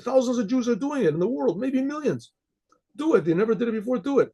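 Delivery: high, pressing persuasion, re-enacted; insistent urging. Urging a reluctant stranger to take the lulav, insistent. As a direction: push him to just do it.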